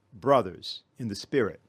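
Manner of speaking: ordinary talk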